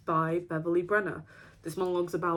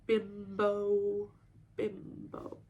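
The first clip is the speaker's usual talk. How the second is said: drawn out, fading to a whisper; slow, fading echo; savoured word; 6 dB quieter, much slower than usual. Acted. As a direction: stretch the word, then let it fade away.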